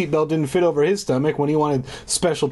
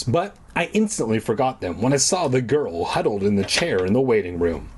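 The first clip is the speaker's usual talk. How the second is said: usual speaking voice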